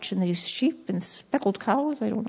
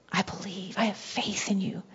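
whisper; 3 semitones lower, 9 dB quieter than usual. Acted. Intimate confession to the Lord.

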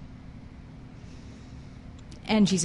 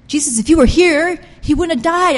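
high, loud, accusing cry at Jesus; offended accusation; 9 semitones higher, 8 dB louder than usual. Retold or imitated. Mary accusing Jesus of not coming, loud and high. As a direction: throw the blame at him, hurt and indignant. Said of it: "I'm sure she didn't say it like that, but that was the spirit behind it".

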